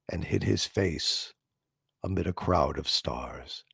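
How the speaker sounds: poem recitation. Acted. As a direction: read it gently to a dear listener, unhurried. Recites Yeats poem to viewer.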